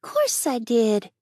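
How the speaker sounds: put-on high, slow, matter-of-fact boy; frank little boy. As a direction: say it plainly, like a child with nothing to hide. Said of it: "said Davy frankly".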